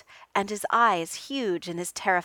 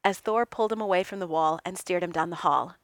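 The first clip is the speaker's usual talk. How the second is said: ordinary delivery, nothing marked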